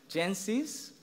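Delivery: ordinary talk, nothing marked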